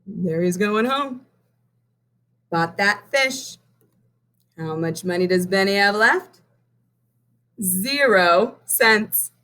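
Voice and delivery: usual speaking voice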